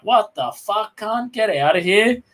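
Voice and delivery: high, put-on voice, telling him off; playing his younger self snapping at the groper; retelling how he told the drunk off, pitched well up; mock outraged yell. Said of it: "And I was like".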